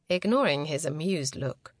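ordinary delivery, nothing marked

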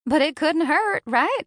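playful friend coaxing. A narrator's reading. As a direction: coax her with a grin. Capri teasing.

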